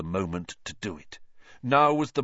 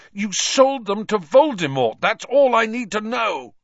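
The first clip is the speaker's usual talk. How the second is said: loud, high furious accusation; angry shout; 12 semitones higher, 7 dB louder than usual. A narrator's reading. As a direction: hurl the accusation at him. Harry accuses his parents' betrayer.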